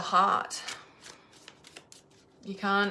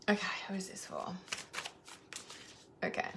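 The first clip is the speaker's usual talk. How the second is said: fast hushed whisper to herself; 13 dB quieter, faster than usual. Real, not acted. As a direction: whisper the question quickly. Whispering to herself while choosing.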